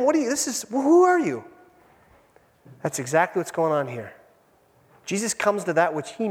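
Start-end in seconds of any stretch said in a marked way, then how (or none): 0.0-1.4 bewildered panic. Retold or imitated. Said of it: "He doesn't know him".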